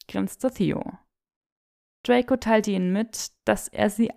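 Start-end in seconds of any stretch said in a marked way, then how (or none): none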